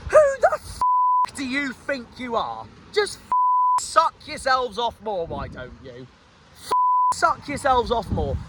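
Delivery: sudden furious squeal cut off, then a seething jibe; angry outburst. Real, not acted. Fan ranting at rival Cardiff's gloating post, angry outburst.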